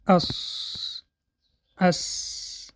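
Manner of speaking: unvoiced whistling hiss on اس. Shown as a demonstration. Teacher demonstrates the whistling sound of سین for students. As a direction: let the air hiss through the teeth on اس. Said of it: "باید هنگام تلفظ این سه حرف".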